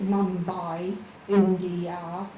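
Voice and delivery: Mumbai, India said slowly, syllable by syllable; split into syllables. Shown as a demonstration. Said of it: "break down the syllables".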